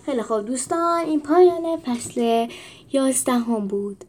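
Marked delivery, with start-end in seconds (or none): none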